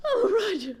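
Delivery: wife hysterically begging her husband to open up; hysterical calling. Acted. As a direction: beg through the door in near panic.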